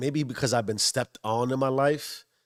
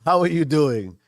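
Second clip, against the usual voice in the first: higher, louder cheerful how are you; bright greeting; 5 semitones higher, 8 dB louder than usual. Shown as a demonstration. A host greeting viewers, bright and lively. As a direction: greet them like you mean it. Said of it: "redoes the greeting brightly".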